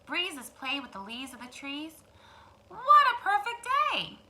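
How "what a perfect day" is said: bright higher duck voice, louder; cheerful duck voice; Ducky Lucky. Acted. Duck greets his friend on a perfect day. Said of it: "said Ducky Lucky".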